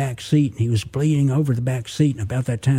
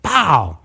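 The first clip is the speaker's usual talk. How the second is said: gunshot pow; 3 semitones higher than usual. Retold or imitated. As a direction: spit out a sharp gunshot. Gunshot sound effect in story.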